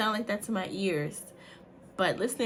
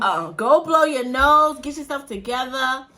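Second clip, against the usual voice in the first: loud, slow, higher-pitched command; scolding order; 6 semitones higher, 11 dB louder, slower than usual. Real, not acted. Mother ordering her son, loud scolding.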